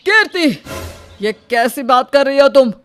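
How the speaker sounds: loud shocked rebuke. Acted. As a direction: call out her name sharply, outraged. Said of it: "आवाज़ नीची रखकर बात करो". (ऊँची आवाज़ में नाराज़गी से टोकते हुए)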